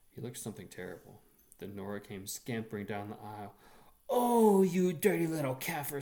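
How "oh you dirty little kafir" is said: shrieking sister, much higher and louder; furious sister. A narrator's reading. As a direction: screech at her brother in fury. Furious sister scolds the boy.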